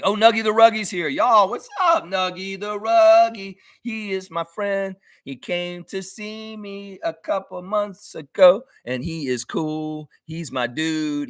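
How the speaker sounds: high, slow, delighted welcome; excited greeting. Real, not acted. Greeting a friend in chat.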